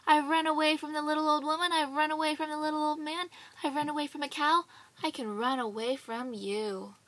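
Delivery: fast, loud bragging call. Acted. Gingerbread boy boasting loudly to the horse. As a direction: rattle off your escapes proudly. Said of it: "called out".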